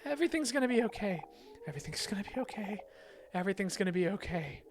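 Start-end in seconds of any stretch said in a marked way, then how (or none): 0.0-1.2 higher voice. Shown as a demonstration. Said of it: "reading character line repeatedly".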